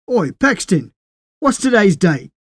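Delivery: a loud call across the platform; yelling. A narrator's reading. Les yelling for Paxton for the date, loud call. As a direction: call out to him. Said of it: "Les yelled for Paxton".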